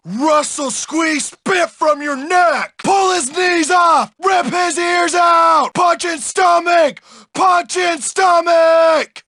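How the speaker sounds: high brute battle cry; fight-crazed yelling. Acted. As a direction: egg on the beating at a shout. Bully yells violent fight commands.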